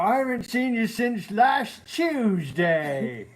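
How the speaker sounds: high, slow hammed-up British voice; mock British accent. Husband keeps up British accent for viewers. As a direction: drawl it like a stage Brit. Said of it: "you do the British one".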